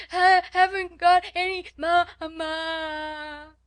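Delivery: put-on high, loud child's wail, last syllable held; tempestuous howl. A narrator's reading. Spoiled child crying for her mother, howling. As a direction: bawl it out like a tantruming child. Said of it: "Lottie howled tempestuously".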